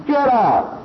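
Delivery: sharp high shout at the donkey; blacksmith shouting. Retold or imitated. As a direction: chase the donkey out with a high yell. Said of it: "The blacksmith said to the donkey".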